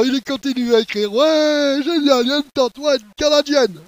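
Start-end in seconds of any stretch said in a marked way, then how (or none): 1.1-3.8 high, loud delighted cheering; happy excitement. Acted. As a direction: exclaim it like a thrilled camper. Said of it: "Il est content". (joie exubérante, voix haute et forte)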